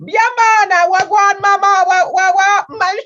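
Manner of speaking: loud, very high-pitched mock Jamaican accent; thick Jamaican accent. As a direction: exaggerate a thick Jamaican accent, babbling loudly. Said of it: "Jamaican accent and".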